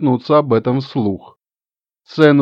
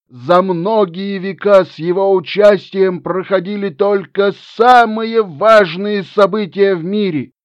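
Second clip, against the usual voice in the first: put-on solemn, higher, louder old gnome; booming biblical narration; 6 semitones higher, 3 dB louder than usual. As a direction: proclaim it like ancient scripture. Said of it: "торжественным голосом вещал дед". (торжественный голос старого гнома)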